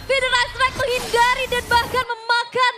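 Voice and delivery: high, loud, slow play-by-play shout; hype shouting caster. Real, not acted. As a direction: scream the highlight call. Peak moment of team fight.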